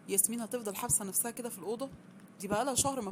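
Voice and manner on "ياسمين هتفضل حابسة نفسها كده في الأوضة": ordinary delivery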